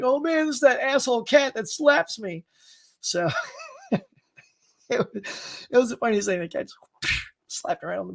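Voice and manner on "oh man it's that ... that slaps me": voicing the dog's gripe.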